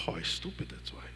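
whispered, giving in to the lie; defeated whisper. Pretend. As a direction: breathe it out as if beaten down. The self agreeing, unvoiced.

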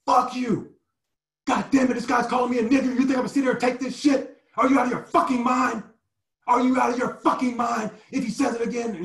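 high, loud, fast furious shouting; furious rant. Retold or imitated. Player raging at the coach over the slurs, high loud furious rant. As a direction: rage at the coach full force. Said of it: "and I just fucking lost it".

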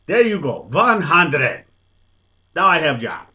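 put-on loud, high accented 'vɑ̃n hɑndəded'; proud Dutchman shouting in broken English; triumphant Dutch accent. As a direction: crow it like you've got the job. Dutchman presents the hundred.

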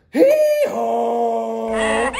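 loud braying hee-haw like a donkey. Acted. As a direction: bray it out like a donkey. Donkey bray in read-aloud.

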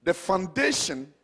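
low, measured preaching; calm sermon opening. Acted. Preacher introducing the sermon title to the congregation, measured. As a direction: lay out the sermon points slowly and steadily.

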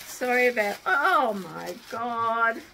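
moved exclamation, drawn out; overwhelmed gasp. Real, not acted. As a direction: caught off guard by the gift. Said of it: "I'm a bit emotional".

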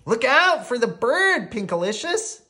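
high, loud warning call; acted urgent shout; hollering. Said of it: "Peter hollered".

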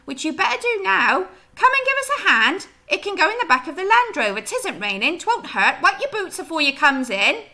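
high, loud West Country wife rattling on; a nonstop gossipy farm wife in full flow; chattering farmer's wife. Acted. Teacher reading Mrs Hoggett to the class, high, loud and chattering.

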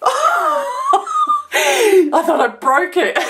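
high, relieved laugh through the words; relieved laughter. Real, not acted. After nearly breaking the globe, relieved laughing. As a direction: laugh off the scare, giddy.

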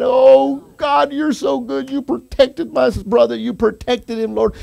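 high, fervent imitated prayer; high-pitched grateful wail, acted; tearful cry of thanks. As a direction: cry out to God in mild re-enacted weeping. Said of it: "We cried".

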